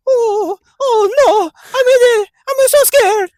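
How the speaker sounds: very high, loud, whimpering Luigi; mock-terrified high cartoon plumber; scared Luigi. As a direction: panic in a squeaky cartoon voice. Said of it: "Luigi should be like".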